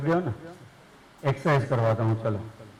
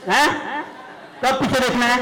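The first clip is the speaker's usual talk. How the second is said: mock scolding; 7 semitones higher than usual. Shown as a demonstration. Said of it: "पहले मैं दिखा देता हूँ".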